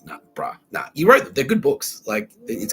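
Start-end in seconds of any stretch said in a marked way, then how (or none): 0.1-0.9 low, breathy brushing off; laid-back dismissal; dismissive. Retold or imitated. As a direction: wave it away casually. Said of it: "I'm like".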